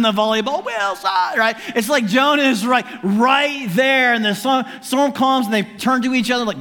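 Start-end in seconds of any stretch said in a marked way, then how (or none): 0.6-1.4 very high, comic wail; mock crying out. Castaway calling to his lost volleyball, mock crying out. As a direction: imitate a castaway's drawn-out cry. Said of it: "He's crying out to Wilson".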